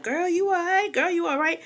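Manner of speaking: high, soft imitation of worried women; high-voiced mock concern; concerned friends. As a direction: pitch it way up, fussing over a friend. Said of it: "while everybody's being concerned".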